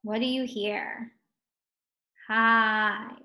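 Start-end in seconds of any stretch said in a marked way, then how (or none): 2.3-3.1 "Hive" drawn out on a level note; slow. Shown as a demonstration. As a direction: hold the long I in "Hive". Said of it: "the reason we say it slowly".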